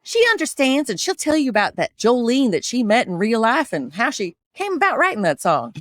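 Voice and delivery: Southern country-star storytelling accent; lilting country Southern accent. Shown as a demonstration. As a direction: tell it in a Tennessee twang. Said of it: "Dolly's a little bit higher".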